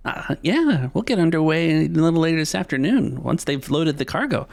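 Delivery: put-on high, quick young sailor voice; eager deckhand. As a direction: rattle off the schedule helpfully.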